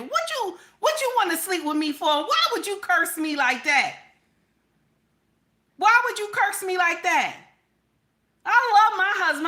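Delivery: high, loud mock outrage; outraged wife. Married woman scolds an unwanted suitor, mock outraged. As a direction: play the offended wife, shrieking at the suitor.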